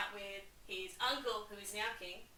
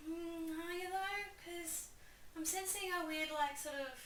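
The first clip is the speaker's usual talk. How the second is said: put-on higher, prying old-man voice; suspicious Polonius; 7 semitones higher, 3 dB quieter than usual. Polonius quizzing Hamlet about his madness. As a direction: sceptical adviser probing. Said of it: "Polonius is like".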